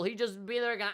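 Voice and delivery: ordinary delivery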